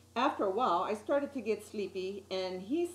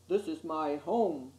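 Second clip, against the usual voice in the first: put-on lower, calm old fish voice; low soothing fish; 5 semitones lower than usual. A narrator's reading. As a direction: soothe the boy like a gentle giant. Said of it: "His voice was so low and soothing".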